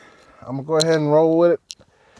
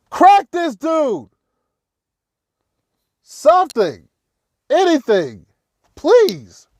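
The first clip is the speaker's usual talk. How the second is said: pleading shout; 7 semitones higher, slower than usual. Real, not acted. Frustrated fan urging the Falcons defense, slow high shout. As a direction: drag it out, begging them to hit.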